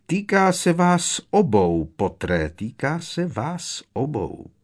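slow, silky villain voice with a hushed end; Malfoy's drawl. A narrator's reading. Malfoy speaks to Harry. (pomalý úlisný hlas padoucha)

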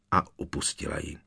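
plain reading voice

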